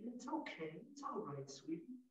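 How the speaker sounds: very hushed, tender comfort; calm a frightened creature, barely audible; soft reassuring murmur; gentle soothing. Shown as a demonstration. Soothing a scared turtle.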